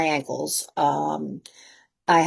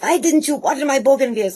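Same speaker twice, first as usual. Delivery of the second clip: high-pitched imitation of an annoyed trainer; mock an indignant boss demanding answers; mad, scolding; 7 semitones higher than usual. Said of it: "he was mad at me".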